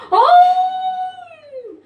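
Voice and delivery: long, high, very loud roar; imitated lion roar. Shown as a demonstration. Preacher shows how far a lion's voice carries, roaring. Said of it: "suaranya itu bisa kedengar".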